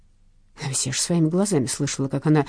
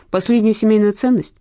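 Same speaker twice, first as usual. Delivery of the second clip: louder indignant question; indignant exclamation; 5 semitones higher, 5 dB louder than usual. A narrator's reading. Detective scolding Lena, indignant cry. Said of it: "возмущённо воскликнула я".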